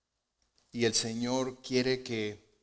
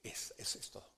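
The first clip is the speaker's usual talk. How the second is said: whispered aside; 20 dB quieter, faster than usual. Real, not acted. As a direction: breathe it out as a secret. Sudden aside after quote. (susurrado, en confidencia)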